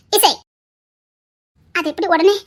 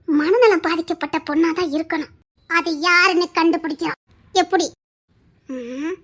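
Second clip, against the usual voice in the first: usual speaking voice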